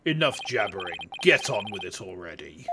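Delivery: put-on impatient prosecutor in a deep British accent. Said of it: "Deep British voice should work".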